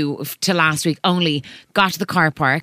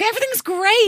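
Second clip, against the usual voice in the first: much higher, mock-bright fake cheer; fake cheery. Mum mocking pretending all is fine, much higher and fake-cheery. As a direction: put on a falsely sunny front. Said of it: "been like".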